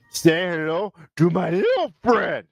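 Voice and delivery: put-on slow Scarface-style Cuban accent. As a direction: growl it like Tony Montana. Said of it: "when I do the Cuban accent".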